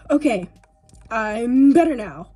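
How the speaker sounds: catching breath after laughing; recovering, still breathless; after laughing hard. Real, not acted. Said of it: "Let me collect myself".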